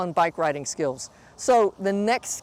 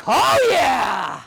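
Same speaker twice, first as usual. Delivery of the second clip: excited shout; 3 semitones higher, 4 dB louder than usual. Real, not acted. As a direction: cheer it out loud. Celebrates the perfect run.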